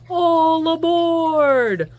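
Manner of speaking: conductor's call. Acted. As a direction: call everyone onto the train. Porter calls passengers.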